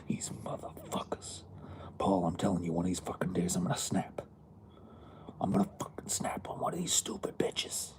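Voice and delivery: hissed whisper, seething; whispered rant. Retold or imitated. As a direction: whisper threats through your teeth. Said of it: "quoting Nico's whispered rant".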